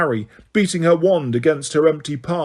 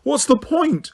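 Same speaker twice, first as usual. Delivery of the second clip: high, pleading, near tears; feigned despair rising; tearful; 7 semitones higher than usual. A narrator's reading. Hermione fake crying.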